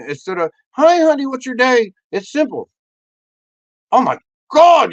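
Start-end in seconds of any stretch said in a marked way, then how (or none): 0.7-1.9 high, loud mock-sweet greeting; hammed-up chirpy hello to a spouse. Acted wife greeting husband. 3.9-4.9 high, loud gushing; hammed-up thrilled reaction; mock excitement. Acted reaction to good day.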